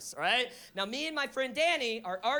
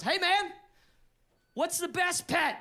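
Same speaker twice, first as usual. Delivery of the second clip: put-on drunk friend calling out; 6 semitones higher than usual. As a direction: hail the stoner across the party. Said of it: "He just goes".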